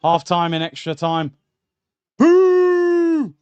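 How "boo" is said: a long, loud, high jeering boo. Real, not acted. Angry fan booing the referee at half time, loud jeer.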